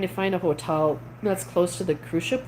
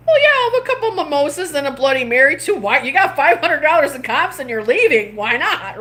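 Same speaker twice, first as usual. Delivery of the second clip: eager acceptance; 8 semitones higher, 6 dB louder than usual. Retold or imitated. Retelling her eager order.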